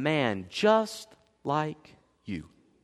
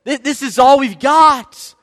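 loud, high, mock-desperate plea; protesting outcry; 8 semitones higher, 11 dB louder than usual. People objecting to wasted water in drought, loud protest. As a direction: cry it out in exasperated protest.

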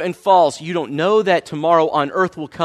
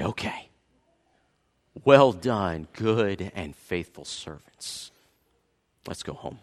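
much softer, lower, slow and tender; gentle welcome; 11 semitones lower, 10 dB quieter, slower than usual. Acted. Christ welcoming faithful servants home, soft and tender. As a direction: hush it like a loving welcome home.

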